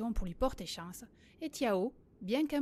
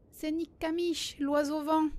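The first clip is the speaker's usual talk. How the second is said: slow, higher-pitched, louder exclamation; excited realization; 5 semitones higher, 4 dB louder than usual. A narrator's reading. Boy realizing who the great eagle is, excited. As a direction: cry it out as a sudden discovery. Sudden realization voiced in story.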